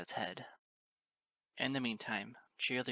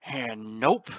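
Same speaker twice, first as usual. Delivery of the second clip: loud growled refusal; 11 dB louder than usual. A narrator's reading. Big stallion stepping in to help, loud growl. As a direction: growl it with menace. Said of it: "Big Mac growled".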